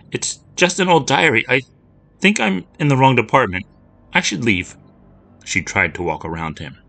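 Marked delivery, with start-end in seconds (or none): 0.1-4.7 put-on much higher, nervous young girl; trying to slip away from a scary man; a frightened child stammering excuses. Lucy nervous before stranger.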